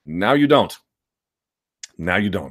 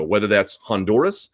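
ordinary talk

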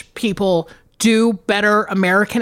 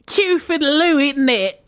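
hammed-up mock British accent, pitched up; cartoonish British accent; 4 semitones higher than usual. Mocking Americans' attempts at accents, cartoonish British accent. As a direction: do a bad American take on British. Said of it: "it's just so cartoonish".

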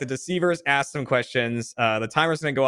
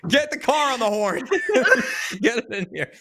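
laughing; 9 semitones higher than usual. Pretend. Laughing mock demand.

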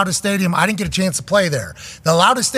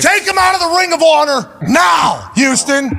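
high, loud playful demand; mock shout; 9 semitones higher, 4 dB louder than usual. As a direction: shout it at the whole city.

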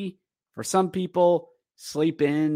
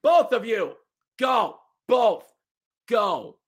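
higher, firm ref's command; referee order; 8 semitones higher, slower than usual. Retold or imitated. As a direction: send both players off like a referee. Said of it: "the ref goes".

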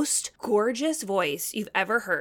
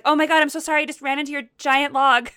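louder, higher-pitched gushing sorry; retold embarrassed apology, pitched up; flustered apology; 5 semitones higher, 6 dB louder, faster than usual. Host retells apologizing to lumberjack after bumping his log, flustered.

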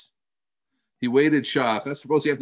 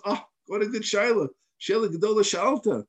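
higher-pitched, admiring exclamation; delighted praise; 7 semitones higher than usual. Retold or imitated. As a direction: marvel at a fine question. Hillel voiced praising a question.

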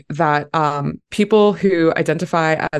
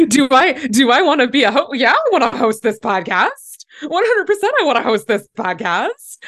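high, laughing and eager; excited; 7 semitones higher than usual. Real, not acted. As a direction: gush about saying yes. Excited to join the podcast.

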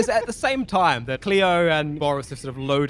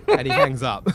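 usual speaking voice

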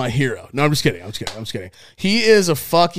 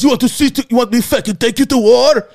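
put-on threatening Israeli accent; 8 semitones higher, 6 dB louder, faster than usual. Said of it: "heavy Israeli accent".

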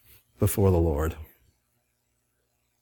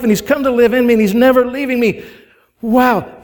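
rising fervor; 8 semitones higher, 4 dB louder than usual. Real, not acted. Preaching climax on Jesus' gift.

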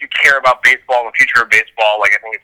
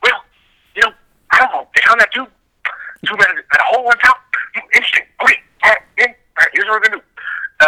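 high, musing coach voice; Rusty impression; 12 semitones higher, faster than usual. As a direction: voice a puzzled coach thinking aloud. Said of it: "I'd like to see Rusty watch it".

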